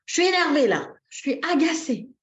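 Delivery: high, loud exasperated outburst; worked-up mock annoyance; acted irritation. Shown as a demonstration. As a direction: vent it emphatically, for demonstration. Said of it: "je suis comme ça et je dis".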